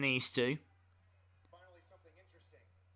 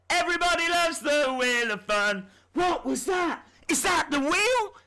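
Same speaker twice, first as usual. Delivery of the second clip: higher, excited hype voice, over the top; mock hype voice; 13 semitones higher, 4 dB louder than usual. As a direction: sell the Wheel of Fun like a showman. Hyping the Wheel of Fun.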